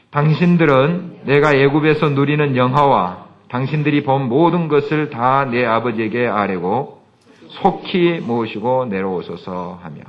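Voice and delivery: loud, slow congregational reading; scripture reading. Acted. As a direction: read it out together, slow and strong. Reads scripture aloud together.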